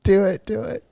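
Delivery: laughing. Real, not acted. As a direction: barely get it out between giggles. Cracking up mid-scene.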